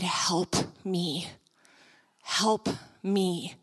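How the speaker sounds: low, soft, slow plea for help; quiet plea. Retold or imitated. Woman in her chair begs the speaker for help, quiet plea. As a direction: plead softly and slowly, low in the voice.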